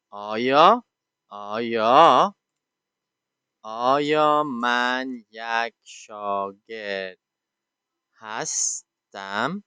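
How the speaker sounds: very slow, each word drawn out, rising on هستم; slow dictation. Shown as a demonstration. Teacher dictating the Dari question while writing. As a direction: spell out the question slowly as you write it. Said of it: "Just like this".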